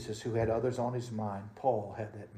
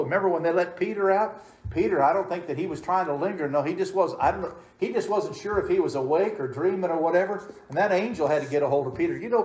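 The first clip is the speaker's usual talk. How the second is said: louder, higher fervent preaching; preaching at full force; 6 semitones higher, 8 dB louder, faster than usual. Acted. Preacher builds again, storytelling.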